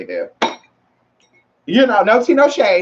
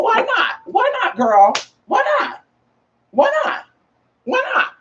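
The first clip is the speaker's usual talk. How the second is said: very high playful squeal; high teasing why not. Acted. Egging the girls on in a high squeal. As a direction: pitch it way up and tease.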